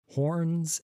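ordinary delivery

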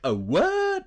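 very high, rising incredulous yelp; mock outrage swooping up into a question; offended disbelief. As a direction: squeak it out, utterly affronted. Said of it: "he just looks so offended".

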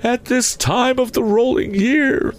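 very high, slow ghostly lament; suffering spectre. A narrator's reading. Spectre telling of seasonal suffering, high mournful voice. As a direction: draw the words out high and mournful. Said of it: "the spectre said".

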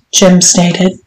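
ordinary delivery, nothing marked

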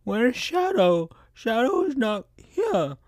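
put-on high, soft toothless girl voice; Amy calling. Amy turns to Tails asking for Shadow, high and soft. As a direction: timidly call his name, high. Amy's voice again.

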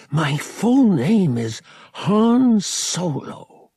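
slow, high, swelling pride; proud announcement. A narrator's reading. Boy announcing his new full name, proud and excited. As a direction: proclaim it, stretching the name out.

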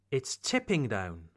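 accent lands on "tipping", falling after it; stress on tipping. Shown as a demonstration. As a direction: lean on "tipping", let "down" drop. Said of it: "imitate my intonation".

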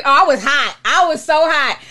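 ordinary talk

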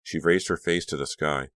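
usual speaking voice

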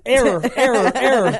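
malfunctioning computer voice, error error; short-circuiting robot; glitching robot. Pretend. She, like, does not compute.

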